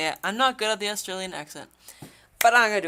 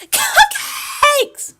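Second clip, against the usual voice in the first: very high, loud squeal; excited squeal; 12 dB louder than usual. Acted. Club member suggesting food for the festival, excited squeal. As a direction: burst out with a shrill squeal. Character blurts out an answer.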